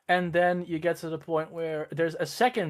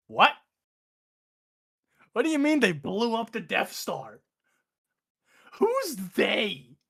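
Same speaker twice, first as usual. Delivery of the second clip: higher, slower incredulous boss voice; exasperated Emperor; 6 semitones higher, slower than usual. Retold or imitated. Emperor scolding over the phone about the Death Star, higher and slower. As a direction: scold a useless underling down the line.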